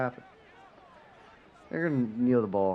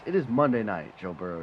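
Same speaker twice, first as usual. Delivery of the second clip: usual speaking voice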